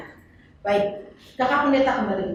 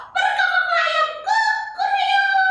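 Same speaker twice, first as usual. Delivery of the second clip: put-on high, laughing kid doing a rooster; high giggly puppet voice crowing; 3 dB louder than usual. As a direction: crow it out playfully like a little girl.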